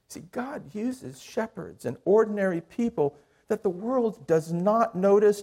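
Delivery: normal talking voice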